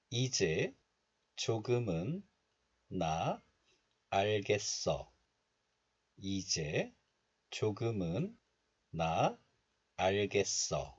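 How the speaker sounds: each word set apart by a pause. Shown as a demonstration. Teacher modelling lyrics for learners to repeat, word by word. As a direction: read the Korean lyric one word at a time.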